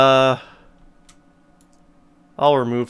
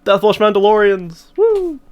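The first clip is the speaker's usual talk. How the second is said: excited cheer; 7 semitones higher, 5 dB louder, slower than usual. Real, not acted. Cheering his played cards.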